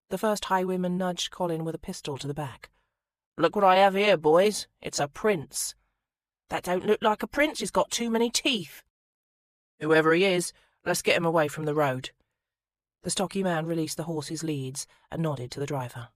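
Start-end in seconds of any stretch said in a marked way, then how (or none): 3.4-5.7 gloating highwayman. Acted. Highwayman shows off his captive. 6.5-8.8 jeering robber. Acted. Second robber jeers at Colin. 9.8-12.1 gruff robber. Acted. Third robber gives orders.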